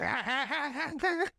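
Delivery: high, rapid "ha-ha-ha-ha"; cartoon wind-up noise. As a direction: imitate a cartoonish boxer winding up. Imitates tweaker's wind-up punch antics.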